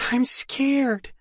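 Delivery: high, tearful admission. Acted. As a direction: fight back tears. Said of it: "Now the tears were streaming".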